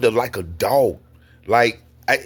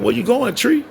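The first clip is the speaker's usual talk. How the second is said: calling out; 10 semitones higher, 3 dB louder than usual. Retold or imitated. Acts friend calling after him.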